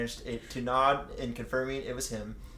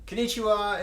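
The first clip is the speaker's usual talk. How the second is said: cheery greeting; 8 semitones higher, 5 dB louder than usual. Acted.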